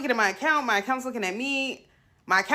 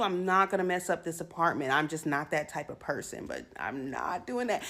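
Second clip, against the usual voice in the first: quiet and firm; 6 semitones lower, 6 dB quieter than usual. Real, not acted. Calm firm close after outburst.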